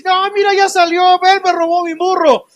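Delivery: high, indignant mock neighbor voice; accusing neighbor. Preacher voicing a neighbor accusing the freed thief, high and indignant. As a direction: play the outraged townsman pointing him out.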